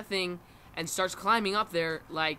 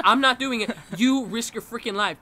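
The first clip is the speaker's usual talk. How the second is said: raised, louder quoted protest; mock indignant refusal; 3 semitones higher, 7 dB louder than usual. Little sister refusing to go up there, imitated indignant protest. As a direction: push back loudly, imitating a kid's refusal.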